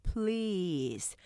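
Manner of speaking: "please" stretched long as a single syllable; long one-syllable please. Shown as a demonstration. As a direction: draw out "please" slowly in one beat. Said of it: "길게 please". ("please" 길게 한 음절로)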